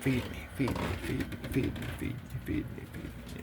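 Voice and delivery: breathy pleading whisper that fades away; mock demanding cat.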